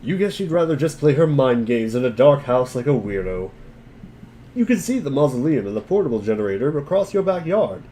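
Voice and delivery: ordinary talk, nothing marked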